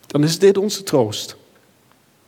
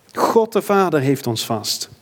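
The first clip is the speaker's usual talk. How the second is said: usual speaking voice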